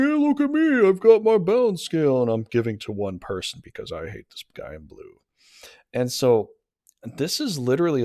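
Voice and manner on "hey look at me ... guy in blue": high, loud, cocky voice of the card figure; boastful card figure. Acted. Tarot reader voices the Robber Baron figure, smug.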